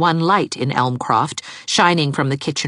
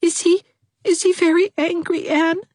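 put-on much higher, weepy girl's voice; weepy young girl; tearful Dovey. A narrator's reading. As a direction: wail her misery. Dovey timid.

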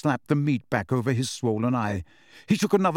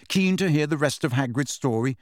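usual speaking voice

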